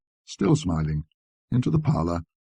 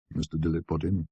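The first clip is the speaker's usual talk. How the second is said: plain reading voice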